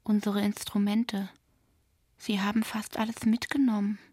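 plain reading voice

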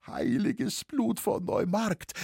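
high, slow whimper; half-choked frightened whine. A narrator's reading. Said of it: "wimmerte der Halberwürgte".

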